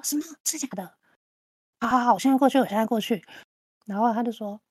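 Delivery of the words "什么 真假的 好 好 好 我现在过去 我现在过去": mock-urgent, louder rush of agreement after a hushed start; feigned nervousness. Faking panic on the friend's rescue call, pretend nervous. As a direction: play-act being flustered by urgent news. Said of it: "我就假装很紧张".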